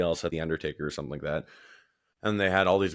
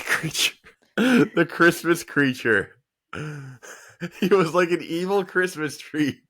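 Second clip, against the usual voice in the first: high, loud voice breaking into laughter; cracking up while talking; real laughing disbelief; 10 semitones higher, 5 dB louder than usual. Real, not acted. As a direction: squeal it through helpless giggles. Cracking up over an absurd gimmick.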